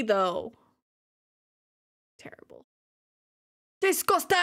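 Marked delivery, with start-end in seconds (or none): 3.8-4.4 pitched-up disgust before a laugh; higher mock disgust. Real, not acted. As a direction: gag playfully, higher.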